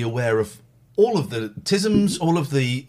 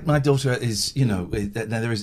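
ordinary talk, nothing marked

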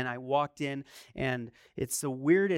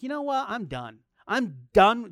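fed-up outburst, the last I'm done much louder; 5 semitones higher, 5 dB louder than usual. Retold or imitated. Preacher retelling to his church, fed-up shout. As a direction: throw up your hands, finished with them. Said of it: "And I'm like".

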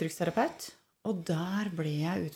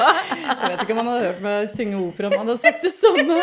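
laughing, rising high and loud; 4 semitones higher, 9 dB louder than usual. Real, not acted. Talking about the doctor and opera, laughing louder and higher. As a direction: joke through a laugh about singing opera.

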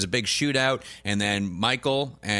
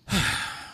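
long voiceless exhale; acted relieved sigh. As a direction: breathe out in mock relief. Acts Michael's relief at it being over.

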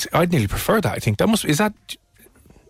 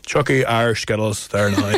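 slow, lower mock Antrim accent; diabolical Antrim accent; 4 semitones lower, slower than usual. Host joking about Irish Skittles, slow bad Antrim accent. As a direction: ham up the Northern Irish accent. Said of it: "apologies for his diabolical accent".

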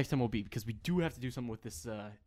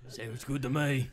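[name] speaking in a lightly put-on Australian accent. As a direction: say it like an Australian barman. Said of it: "well, it's just Australian".

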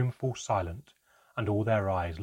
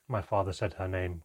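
ordinary delivery

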